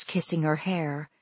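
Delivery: usual speaking voice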